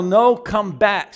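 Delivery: slow, lightly voiced broken English; mild echo of prophet. Preacher recalling the prophet's final words, slow. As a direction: quote the prophet's words again, slowly. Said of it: "when he finally said the final".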